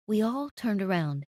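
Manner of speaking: plain reading voice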